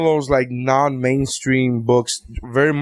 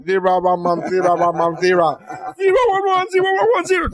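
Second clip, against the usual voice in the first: garbled binary, pitched way up; robot saying binary; 3 semitones higher than usual. Retold or imitated. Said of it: "And she's like".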